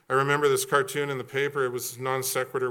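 ordinary talk, nothing marked

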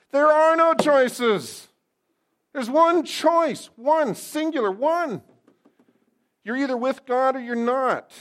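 loud, high, forceful shout; emphatic pulpit yell, well above normal pitch; barky preaching. Real, not acted. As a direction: bark it out at the congregation, hammering each phrase. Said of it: "to be all barky".

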